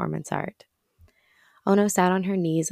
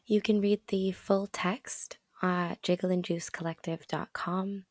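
whispery lost voice; straining through a lost voice; voice giving out; 2 semitones higher, 4 dB quieter, slower than usual. Real, not acted. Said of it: "I have lost my voice".

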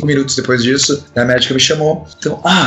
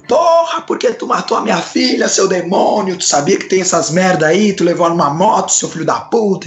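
put-on much higher, furious shouting old man; an angry father screaming accusations in the clinic; old man yelling; 5 semitones higher than usual. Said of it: "chega um velho gritando".

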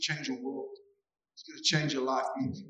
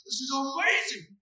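much higher, bursting with joy; excited; 10 semitones higher than usual. Retold or imitated. Preacher imitating a believer, thrilled and much higher. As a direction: shout it like a kid on Christmas morning. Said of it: "You're like".

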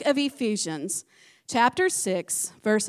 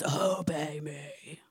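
much lower, very slow, a big voice from the clouds; slow deep divine command "Obey me"; booming voice of God; 9 semitones lower, 9 dB quieter, much slower than usual. Acted. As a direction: drag it out low like thunder behind it. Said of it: "all at once the Lord says".

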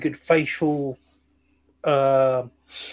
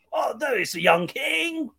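higher, slow, theatrical proclamation; mock Shakespearean actor; 8 semitones higher, slower than usual. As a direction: announce the king with hammed-up grandeur. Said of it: "Patrick Stewart, every sentence".

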